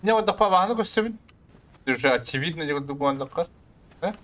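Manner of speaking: usual speaking voice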